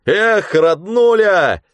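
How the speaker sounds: high, loud, slow drawn-out yell; joyful shout. Acted. Mitka shouts joyfully as he dives into the waves. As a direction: whoop it before the plunge. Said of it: "крикнул".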